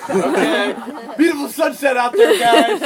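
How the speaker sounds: loud, high and over-bright small talk; a hammed-up fake cheer to smooth over a mess; awkward cheery cover. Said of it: "It's like".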